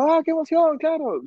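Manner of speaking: excited gush. Retold or imitated. Roommate reacting to the vasectomy news, high excited gush. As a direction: squeal it like a thrilled friend.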